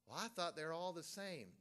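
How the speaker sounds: mock objection. Preacher imitating someone who thought all Bibles matched, mock protest. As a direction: voice a naive doubter's protest. Voices objector, then answers himself.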